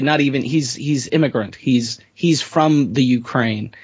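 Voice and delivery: normal talking voice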